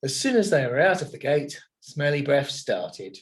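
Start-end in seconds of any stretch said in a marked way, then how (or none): none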